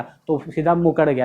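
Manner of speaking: ordinary delivery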